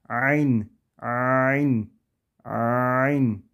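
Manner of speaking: Ayn said with the throat squeezed tight. Shown as a demonstration. Teacher showing students the squeezed throat ayn. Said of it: "Boğazımızı biraz kasıyoruz".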